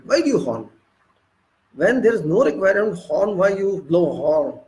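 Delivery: ordinary talk, nothing marked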